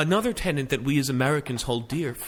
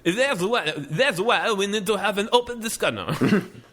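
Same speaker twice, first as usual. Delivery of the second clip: put-on Borat accent; 4 semitones higher than usual. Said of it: "I was gonna end up sounding like Borat".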